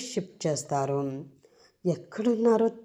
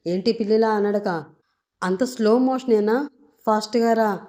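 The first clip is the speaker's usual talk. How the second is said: louder, impatient hurry-up call; loud scolding; 4 semitones higher, 5 dB louder than usual. Acted. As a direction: yell at her to stop dawdling. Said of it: "అని గట్టిగా అనేసరికి".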